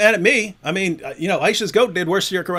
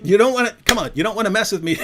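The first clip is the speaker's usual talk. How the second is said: ordinary delivery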